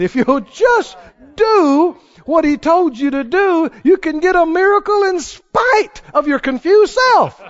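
high-pitched, fervent preacher delivery; impassioned preaching. Real, not acted. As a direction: drive it home to the congregation. Preaches at full force.

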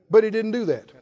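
normal talking voice

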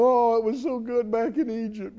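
mock belly-aching, lightly put on; griping. Preacher mimics Israelites missing Egypt, griping. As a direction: grumble wistfully, mimicking complainers. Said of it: "griping and belly aching and go".

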